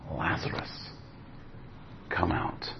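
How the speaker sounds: a hushed call into the tomb; whispered command. Retold or imitated. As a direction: breathe it out, no voice. Jesus voiced calling Lazarus.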